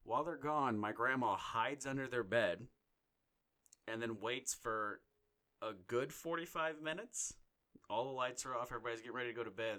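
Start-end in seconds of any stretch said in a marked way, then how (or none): none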